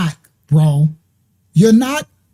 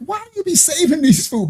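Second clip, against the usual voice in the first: exasperated question; 9 semitones higher than usual. Retold or imitated. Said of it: "I'm like".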